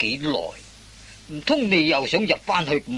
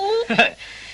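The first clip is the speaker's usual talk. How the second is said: triumphant laugh. Acted.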